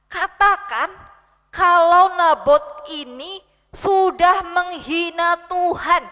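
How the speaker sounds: queen dictating a scheming royal decree, slow and loud. Acted. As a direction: dictate it like a cold royal order.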